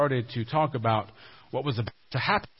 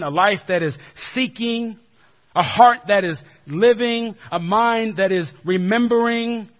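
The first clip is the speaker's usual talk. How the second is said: louder, higher rhythmic litany; rising preaching; 5 semitones higher, 7 dB louder than usual. Acted. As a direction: drive each line home to the congregation.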